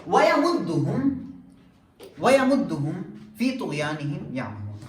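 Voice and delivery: measured chanted recitation of the verse; قرآن کی تلاوت کے انداز میں; Quran recitation. Shown as a demonstration. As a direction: intone the Arabic verse like tilawat.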